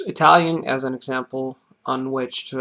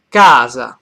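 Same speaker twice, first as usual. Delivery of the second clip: "casa" with CA hit loud, then falling away; stress on first syllable; 11 dB louder than usual. Shown as a demonstration. Teacher giving an Italian example, stress on the CA of "casa". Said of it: "the accent falls on the first syllable".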